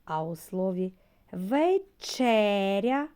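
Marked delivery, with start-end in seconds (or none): 1.3-3.1 «вечеря» said very slowly and loud, with ЧЕ stressed; slow syllable stress. Shown as a demonstration. Teacher to children, stretching the word so they find the stress. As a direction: draw out «вечеря», hitting ЧЕ hardest. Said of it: "з'ясувати, який склад ми вимовляємо з більшою силою".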